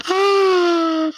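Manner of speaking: sharp gasp into a high, long "oh"; horrified gasp. Retold or imitated. Mortified reaction to thunk on table.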